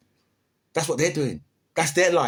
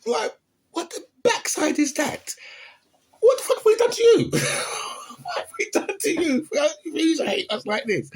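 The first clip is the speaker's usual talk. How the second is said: outraged disbelief. Real, not acted. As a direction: wail at the injustice in disbelief. Outraged at Latinos' hostility.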